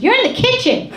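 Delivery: put-on high-pitched kid's indignant shout; indignant yell. Daughter answers mom after walking in, indignant yell. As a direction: yell back like an outraged daughter. Acts out daughter yelling back.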